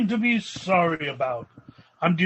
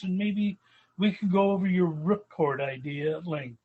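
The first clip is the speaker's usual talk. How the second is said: ordinary delivery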